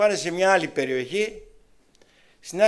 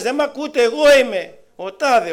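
higher, louder calling out to be heard; soul calling to unhearing people, higher and louder; retold shout; 7 semitones higher, 7 dB louder than usual. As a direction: call out as if nobody hears you. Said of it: "Άρχισε να τους φωνάζει".

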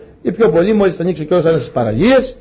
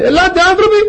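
high-pitched mock summons; calling out; 13 semitones higher, 3 dB louder, faster than usual. Noah inviting people who will not come, calling out.

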